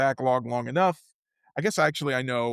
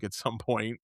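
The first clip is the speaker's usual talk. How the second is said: ordinary talk, nothing marked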